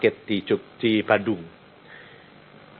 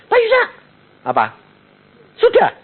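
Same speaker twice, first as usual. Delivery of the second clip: much higher and louder call; urgent daughter; 4 dB louder than usual. Acted. Daughter calls the pastor as the shaking gets worse, high and loud.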